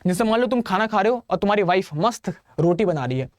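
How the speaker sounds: ordinary talk, nothing marked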